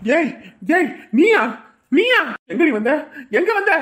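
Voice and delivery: high, loud outburst of angry disbelief; furious shock. Acted. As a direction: fire short shouted questions at an unwelcome visitor. Shocked, furious at returned woman. (ஆத்திரத்தில் கத்தும் குரல்)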